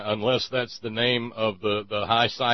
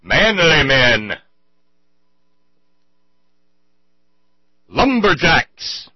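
booming, drawn-out manly declaration; loud slow mock echo; 4 semitones higher, 8 dB louder, slower than usual. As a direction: boom it out slowly like a he-man.

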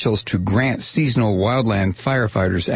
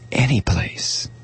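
whispering over a crib; 4 dB quieter, much slower than usual. Retold or imitated. Hushing whisper.

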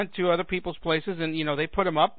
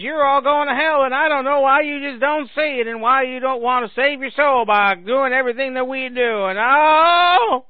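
high, loud hellfire harangue; mock zealot; 6 semitones higher, 6 dB louder, slower than usual. Believer warning sinners they are damned, hammed-up loud wail. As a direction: build to a wailing cry. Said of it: "in the Left Behind books, it says".

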